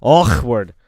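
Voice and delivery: Awkward with a throaty k, loud; odd awkward. Shown as a demonstration. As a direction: say Awkward with a rough ch sound for the k. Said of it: "weird sound that you can make".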